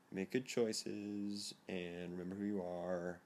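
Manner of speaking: slow, soft, mock-tender send-off; drawn out like a parent at the door; mock parental advice.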